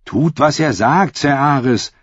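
erhöhte, eindringliche Bitte; drängend. A narrator's reading. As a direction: rede dem Ritter leicht drängend zu. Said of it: "drängte Drei". (eindringliches Drängen)

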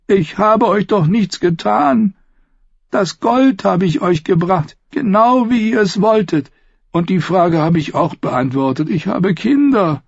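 high, desperate, whining plea; pleading captive. Acted. Captive pleads with his hangmen for mercy, desperate. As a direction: beg them, piling up reasons.

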